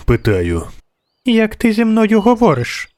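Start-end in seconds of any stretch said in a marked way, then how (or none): 1.2-2.9 put-on high-pitched indignant child princess; high, imperious child voice; haughty little girl. A narrator's reading. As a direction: voice a snooty small girl, pitched way up. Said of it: "Дівчинка гордовито задерла голову".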